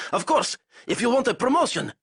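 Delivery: high, loud hasty concession; blurted in fear. Acted. Zayson blurting to Kurov with a rifle on him, high panicked. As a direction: give in fast under the gun. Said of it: "Zayson blurted".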